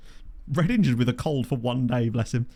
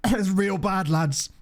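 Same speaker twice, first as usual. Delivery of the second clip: light mock South African accent; sickly player in a South African accent; 6 semitones higher, much slower than usual. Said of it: "But with a South African accent".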